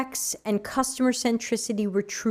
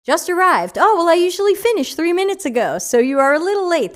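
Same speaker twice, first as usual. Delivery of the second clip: playful teasing; 6 semitones higher, 5 dB louder than usual. Real, not acted. Teasing late friend.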